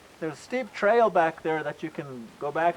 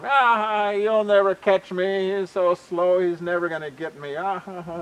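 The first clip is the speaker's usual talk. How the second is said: crowing singsong mockery with ha-ha; laughing taunt; 4 semitones higher than usual. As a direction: taunt him from safe out of reach. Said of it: "looking down at him and laughing at him".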